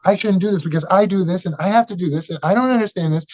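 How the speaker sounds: an employee listing excuses to the boss, whining; mock defensive whine. Shown as a demonstration. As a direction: rattle off excuses in a high whine. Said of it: "Because you're being defensive".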